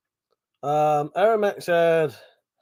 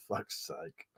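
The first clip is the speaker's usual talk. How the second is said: usual speaking voice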